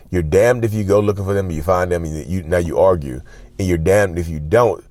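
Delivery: usual speaking voice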